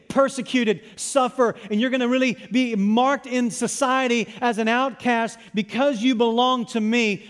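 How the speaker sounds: loud, high rallying speech; forceful, driving list of hardships; Jesus. Acted. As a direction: call recruits while warning them hard. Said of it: "Here's Jesus".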